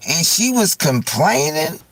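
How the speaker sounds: ordinary talk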